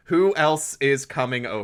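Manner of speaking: "who else is coming over" enunciated word by word; "who else is coming over" in careful standard speech; careful enunciation. Retold or imitated. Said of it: "What the fuck did you say?".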